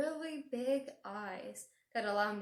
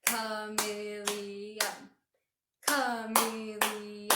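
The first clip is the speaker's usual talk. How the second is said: "Chameleon" chanted slowly in four even beats; "Chameleon" as a slow, level clap-along chant; clapped syllables; 4 dB louder, much slower than usual. Shown as a demonstration. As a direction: stretch "Chameleon" out syllable by syllable on one note. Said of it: "You hear it's four syllables".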